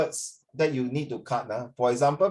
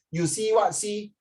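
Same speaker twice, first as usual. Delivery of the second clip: literal Singlish; 6 semitones higher, slower than usual. Shown as a demonstration. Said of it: "I literally translate from Chinese to English".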